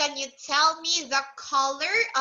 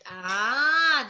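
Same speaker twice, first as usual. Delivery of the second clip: usual speaking voice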